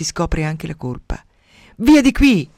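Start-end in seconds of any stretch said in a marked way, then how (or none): 1.8-2.5 grido di sdegno, alto e forte; imperious shouted order, pitched high. A narrator's reading. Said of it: "grida Diana". (grido imperioso)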